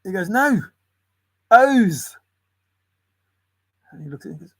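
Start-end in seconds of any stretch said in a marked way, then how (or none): 0.3-2.2 mock insisting customer. Customer correcting in sketch.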